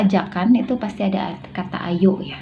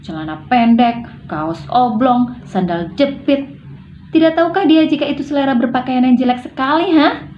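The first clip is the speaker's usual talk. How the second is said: exasperated scolding, higher and louder; annoyed; 6 semitones higher, 4 dB louder than usual. Shown as a demonstration. Scolding Salonga about a child copying his clothing style, irritated. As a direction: complain irritably, ending on a high demanding question. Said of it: "intonasi kesal".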